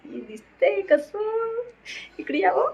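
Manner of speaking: high, slow playful aside; playful camera aside. Acted. Showing herself on camera. (कॅमेऱ्याशी उंच आवाजात खेळकर बोलणे)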